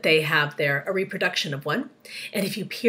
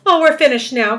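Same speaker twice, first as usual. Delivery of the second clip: loud, raised wail of despair; crying out; 5 semitones higher, 12 dB louder than usual. A narrator's reading. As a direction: cry it out as if all is lost. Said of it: "cried Miss Spider".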